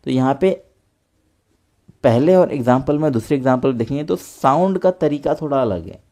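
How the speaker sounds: normal talking voice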